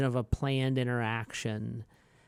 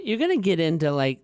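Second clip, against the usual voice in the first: ordinary talk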